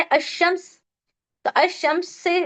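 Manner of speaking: अशम्स said as "ash-shams", no l before the श; अशम्स with a doubled श and no l sound; lam silent. Shown as a demonstration. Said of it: "शीन से क्या है?". (अशम्स बिना लाम के, श पर ज़ोर)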